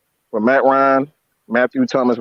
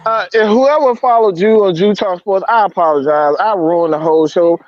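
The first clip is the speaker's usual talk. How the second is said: high, overwrought apology; stressed out; 6 semitones higher than usual. Real, not acted. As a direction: apologize in a raised, upset voice. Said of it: "but I'm stressed out".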